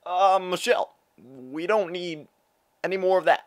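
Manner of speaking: high, loud, halting statesman voice; former president impression. Former president talking to Michelle, loud halting impression. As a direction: stretch words and pause between phrases, loudly. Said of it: "I have seventeen impressions for you".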